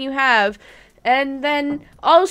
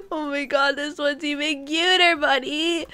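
normal talking voice

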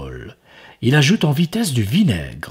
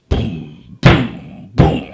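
loud knocking booms; 4 dB louder, much slower than usual. A narrator's reading. Knocking sounds.

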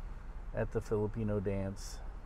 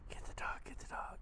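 soft playful whisper; 14 dB quieter, faster than usual. Real, not acted. Whispering to baby.

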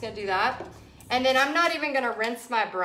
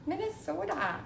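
softer, higher mock Minnesota accent; 6 semitones higher, 7 dB quieter than usual. Painter playfully repeating the state's name, soft Minnesota accent. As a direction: echo it lightly in a Minnesota accent. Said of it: "I love y'all's accent".

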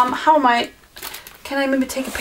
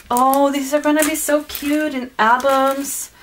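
ordinary delivery

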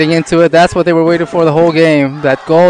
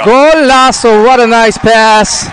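slow, high, louder "Golazo" stretched out; drawn-out golazo; 8 semitones higher, 4 dB louder, slower than usual. Real, not acted. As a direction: savour the goal, stretching each word. Savouring the goal.

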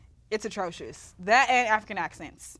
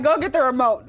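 louder mock command; amused, raised-voice order; parent's order; 3 semitones higher, 8 dB louder than usual. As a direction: imitate a parent ordering a kid. Parent ordering a four-year-old.

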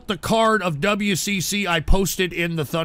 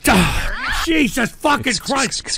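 exasperated shouting; 5 semitones higher than usual. Real, not acted. Streamer cut off by sound alerts, furious shouting. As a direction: explode at the constant interruptions.

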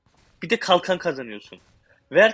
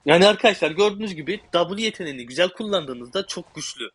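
normal talking voice

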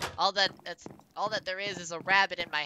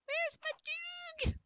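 very high, slow, drawn-out wail; high whiny voice; 3 dB quieter, slower than usual. Acted. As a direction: whine it high like a cartoon. Dramatic high wail for lost dog.

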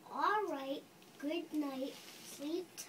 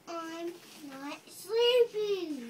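put-on a child's complaint, read with feeling and a bit louder; passionate kid's part; 3 semitones higher, 7 dB louder than usual. Child reading the stubborn kid's line with passion. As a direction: protest like the kid who won't sleep. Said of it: "you read with passion with the kids' part".

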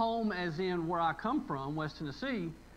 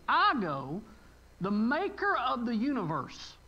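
preaching at full force; 3 semitones higher, 3 dB louder than usual. Acted. Preacher proclaiming Jesus' promise to the congregation, forceful. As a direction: build each I go like a proclamation.

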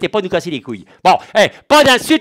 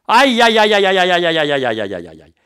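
dramatic groaning aïe; 2 semitones lower than usual. Acted. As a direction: groan in mock dread, then die away. Dreading the reveal.

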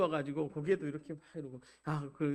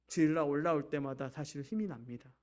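ordinary talk

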